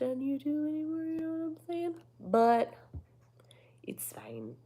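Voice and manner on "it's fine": breathy whispered aside. Real, not acted. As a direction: whisper it to yourself. Muttered self-deprecation.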